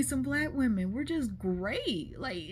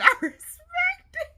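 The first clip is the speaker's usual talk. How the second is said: laughing; 6 dB louder, slower than usual. Real, not acted. Laughing through repeated line.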